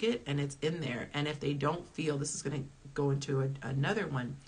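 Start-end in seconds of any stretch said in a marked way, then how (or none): none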